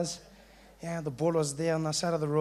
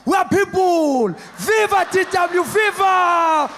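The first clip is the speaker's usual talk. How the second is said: much higher, loud triumphant chanting; rally shout; 8 semitones higher, 9 dB louder, much slower than usual. Retold or imitated. Friends celebrating D W, loud triumphant rally chant.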